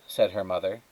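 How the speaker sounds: ordinary delivery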